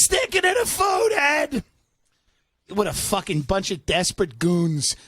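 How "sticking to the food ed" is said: hammed-up high shouting match; mock quarrel. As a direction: shout back at the spouse. Continues acted quarrel.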